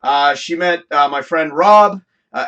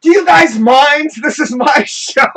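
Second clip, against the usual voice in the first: raised, higher, slow scolding at her guests; retold on-air blow-up, louder and slower; irritated scolding; 9 semitones higher, 7 dB louder, slower than usual. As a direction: tell them off on your own show, half-laughing. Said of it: "She blasts off on me".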